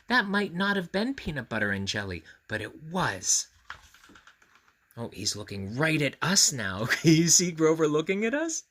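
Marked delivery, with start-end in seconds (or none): none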